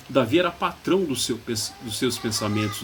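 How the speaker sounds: ordinary delivery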